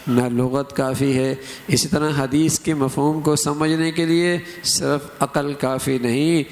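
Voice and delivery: ordinary talk, nothing marked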